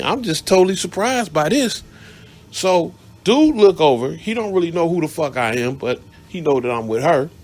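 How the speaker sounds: ordinary talk, nothing marked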